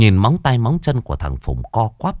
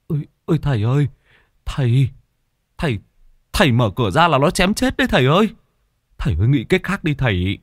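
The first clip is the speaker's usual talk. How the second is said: high, urgent alarmed begging; anxious pleading; 6 semitones higher, faster than usual. A narrator's reading. Frightened relatives beg the master not to open the door, anxious pleading. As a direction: plead hurriedly with rising panic. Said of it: "vội can".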